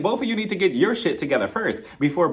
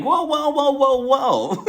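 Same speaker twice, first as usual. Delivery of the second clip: alarmed whoa; 9 semitones higher, much slower than usual. Retold or imitated. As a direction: pull her back in shock. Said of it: "And I'm like".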